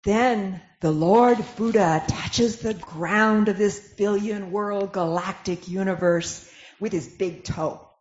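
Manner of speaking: ordinary talk, nothing marked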